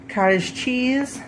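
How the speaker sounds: ordinary delivery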